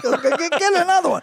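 lightly voiced high quip; high put-on voice. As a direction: toss it off in a high voice. Retold line in put-on voice.

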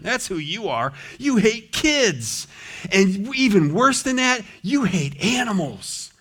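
hammed-up sarcastic accusation. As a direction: accuse him with mock outrage. Mock accusation against Moses.

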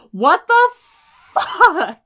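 high, slow exasperated outburst; outraged disbelief. Real, not acted. Fan outraged by the finale's parallels, high and drawn out. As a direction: drag it out in real outrage. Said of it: "I'm so mad about it".

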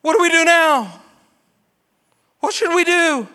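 high, loud panicked cry; desperate plea. Retold or imitated. Crowd crying out to Peter, high and panicked. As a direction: beg for an answer in panic. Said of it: "looked at Peter and said".

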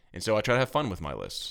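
usual speaking voice

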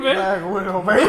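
loud, high laughing cheer. Retold or imitated. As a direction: shout it back, cracking up. Echoing the hyped quote, laughing.

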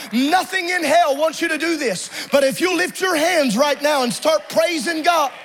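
ordinary delivery

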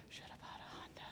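furtive, near-silent murmur; barely audible whisper. Retold or imitated. Hiding three syllables at prayer meeting, furtive whisper. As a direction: mumble it so nobody finds you out. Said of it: "not saying it any louder than that".

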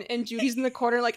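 ordinary delivery

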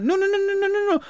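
high frantic no no no; high panicked child protest; panicked kid. Retold or imitated. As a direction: squeal it like a freaked-out child. Kid panics at doors of death.

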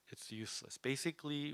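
ordinary talk

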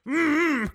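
high, long mouth-full hum; high closed-mouth summons; imitated caveman grunt. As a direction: grunt urgently with lips shut, mimicking a caveman. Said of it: "motions her over to the table like a caveman".

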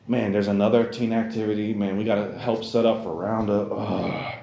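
mock grumpy moan ending in a groan; reluctant complaining.

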